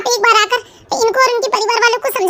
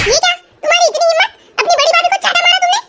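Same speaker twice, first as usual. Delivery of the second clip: higher, fast indignant scolding; outraged mother-in-law; 6 semitones higher, faster than usual. Acted. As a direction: snap at the daughter-in-law in shock. (ऊँची आवाज़ में तेज़ गुस्से भरी डाँट)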